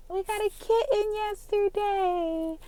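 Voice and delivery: put-on very high, slow baby-talk coo; gushing. Shown as a demonstration. Owner announcing the new kitten, gushing. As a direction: coo it sky-high, stretching every word. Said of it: "Notice the change of tone".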